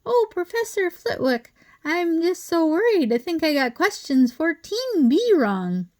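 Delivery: high squeaky teasing impression of a nervous pupil. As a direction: simper up high like a fretting swot. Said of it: "he put on a high, squeaky voice".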